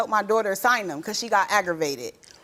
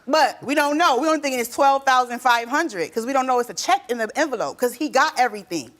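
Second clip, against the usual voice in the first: ordinary delivery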